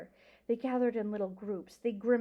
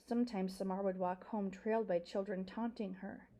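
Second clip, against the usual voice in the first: ordinary delivery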